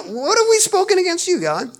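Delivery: high, loud mock-innocent whine; preacher mimicking the people's feigned innocence; clueless people. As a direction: play dumb, all innocence. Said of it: "they don't know".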